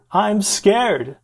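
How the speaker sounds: high, loud retold panic; mock scared yell. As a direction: imitate a frightened patient yelling over his friend. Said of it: "this poor guy is yelling".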